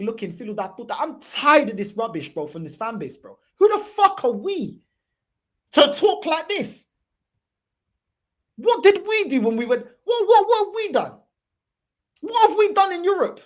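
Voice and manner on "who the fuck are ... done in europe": exasperated rant. Real, not acted. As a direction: hammer the question home to the fans. Ranting at his own fans.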